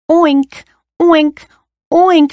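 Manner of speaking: high, punchy oinks; squealing pig. A narrator's reading. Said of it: "squealed the pig".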